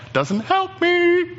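high, drawn-out mock wail; mock complaint. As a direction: stretch the protest high and slow. Listener's objection, repeated as complaint.

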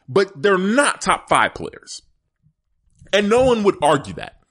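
ordinary delivery

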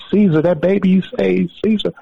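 high-pitched imitated holler to her husband; retold screaming shout. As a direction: act out a woman shrieking for her husband. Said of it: "she was screaming, shouting".

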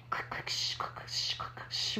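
soft unvoiced clicks and a long shh; spooky whispered clicks. A narrator's reading. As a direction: whisper the creepy sound like a campfire tale. Scary sound chasing her.

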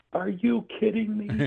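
high, slow, drawn-out disbelief; incredulous. Retold or imitated. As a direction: replay the shock as if hearing it again, way up high. Retold his disbelief at the news.

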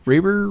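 "ay" vowel in Reiber, unsure; tentative mispronunciation. Real, not acted. Chair trying the surname another way, questioning RAY-ber for Reiber.

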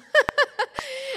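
big delighted laugh; loud high laugh. Real, not acted. Laughing at memory.

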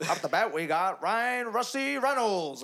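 high, loud, drawn-out introduction; mock baseball announcer. Friend copying the old player bit, high loud announcer voice. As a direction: belt the batter's name slowly.

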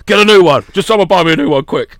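loud, high, exasperated demand; hammed-up impatient outburst; mock tantrum. Acted tantrum over broken gun.

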